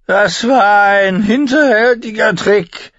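put-on drunken archer grumbling at the tourney; drunk complaining archer. A narrator's reading. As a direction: complain like a drunk sore loser. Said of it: "beschwerte sich ein betrunkener Bogenschütze".

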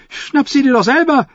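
very high, squawking fool; jester's retort. A narrator's reading. The fool refuses Timion's order, high-voiced retort. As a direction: throw it back in a high jester's voice. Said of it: "gab Shackwell zurück".

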